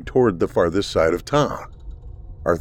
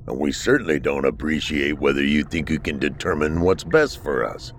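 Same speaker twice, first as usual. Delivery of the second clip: low resentful creature voice; 5 semitones lower than usual. Acted. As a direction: hostile and low, full of grudge. Creature rants against mainland.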